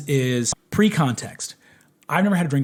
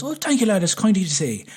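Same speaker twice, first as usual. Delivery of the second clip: light Irish singer voice; 6 semitones higher, faster than usual. Retold or imitated. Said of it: "he goes".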